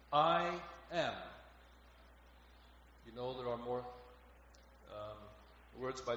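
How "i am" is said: "I am" drawn out and raised; emphasis on "I am". Shown as a demonstration. Preacher highlighting Jesus's first words, "I am" stressed slowly. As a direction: give both words of "I am" full weight. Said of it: "an emphasis here on those first words".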